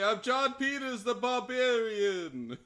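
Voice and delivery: slow, stretched-out barbarian introduction; John Peters. Acted. Player introducing the barbarian to Abel, slow proud declaration. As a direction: announce yourself with mock grandeur. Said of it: "I say".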